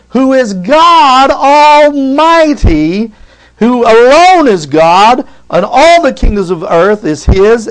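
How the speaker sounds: loud, high, slow preaching build; full-force preaching. Acted. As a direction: thunder out God's greatness from the pulpit. Preaching about God's greatness.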